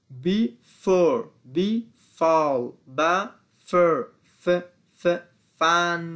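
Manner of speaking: short ف sounds, then "father", "fast", "fan" drawn out firmly; F sound drill. Shown as a demonstration. Teacher drilling F words for learners, slow emphatic model pronunciation. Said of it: "مخرج حرف اف ف".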